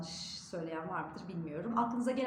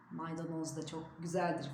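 usual speaking voice

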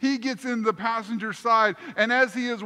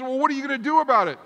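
fast, louder, higher pushy question; exasperated demand; 5 semitones higher, 6 dB louder, much faster than usual. Retold or imitated. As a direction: fire it off, pressing the friend hard. Retold exasperated demand to friend.

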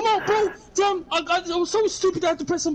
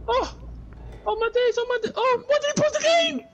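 panicked gamer; 7 semitones higher than usual. Real, not acted. Panicking as enemy attacks.

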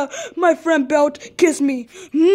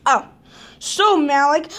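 ordinary talk

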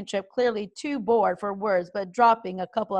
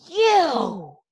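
louder, higher angry hiss; hissing dragon; 6 semitones higher, 6 dB louder than usual. A narrator's reading. Fireworm hissing furiously at Toothless. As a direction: spit the word at him. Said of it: "hissed Fireworm".

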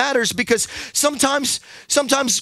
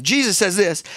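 ordinary talk, nothing marked

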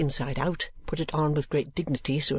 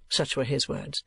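ordinary delivery, nothing marked

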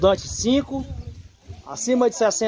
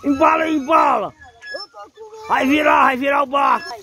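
high, loud, repeated cries of embala macho; excited yelling; 7 semitones higher, 3 dB louder than usual. Real, not acted. Man on the swinging boat yelling at Paulo, loud and high. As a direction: whoop and yell as the boat swings higher.

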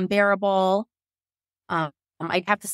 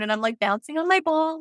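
normal talking voice

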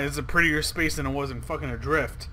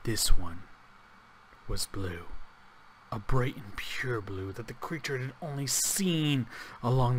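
low, soft storyteller voice; hushed story narration; 6 semitones lower, 6 dB quieter than usual. A narrator's reading. Gamer reading the found note aloud, hushed narration. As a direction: read it quietly and gravely, letting pitch sink.

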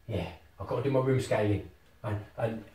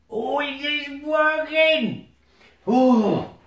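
very high, drawn-out cry of delight; thrilled surprise; 10 semitones higher, 3 dB louder, much slower than usual. Real, not acted. Player seeing the connection work, thrilled. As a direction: squeal it slowly, then a loud oh.

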